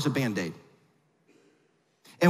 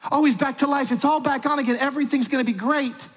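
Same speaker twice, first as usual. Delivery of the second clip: sudden bright, higher burst of hope; giddy relief; 7 semitones higher than usual. Pretend. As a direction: sound like your candidate just came back to life. Imagined hopeful supporter.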